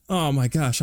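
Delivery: ordinary talk, nothing marked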